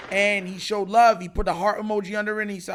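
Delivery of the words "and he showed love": ordinary talk, nothing marked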